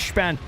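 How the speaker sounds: "Span" begun with a sh sound. Shown as a demonstration. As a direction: confirm it as "Shpan", sh up front. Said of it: "sounds like S H".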